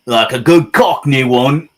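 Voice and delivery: loud, high, put-on Cockney; Cockney accent. Painter shows off the British accent he likes, loud Cockney. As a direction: belt it out in broad Cockney. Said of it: "I do like a good British accent".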